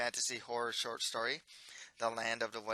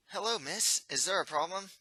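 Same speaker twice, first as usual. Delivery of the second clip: lightly raised, polite prince voice; a courteous young royal; 7 semitones higher than usual. Acted.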